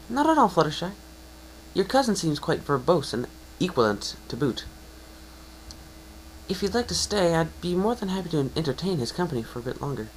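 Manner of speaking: high, prim, well-spoken pony voice; polite scholarly mare. Acted. Librarian graciously inviting the guest to stay, high and prim.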